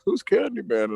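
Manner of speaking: high, baffled question; puzzled coworker. Retold or imitated. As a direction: ask it like you've never heard the name. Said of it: "others were like".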